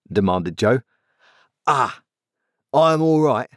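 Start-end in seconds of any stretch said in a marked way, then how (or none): 1.7-3.5 grudging workman's answer, pitched up; gruff. A narrator's reading. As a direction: answer gruffly, still sulking a little. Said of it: "said gruff old Orlick".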